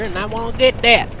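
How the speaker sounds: ordinary delivery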